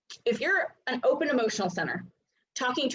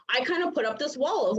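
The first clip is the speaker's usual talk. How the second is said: usual speaking voice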